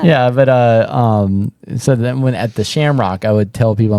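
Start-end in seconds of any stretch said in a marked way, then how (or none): none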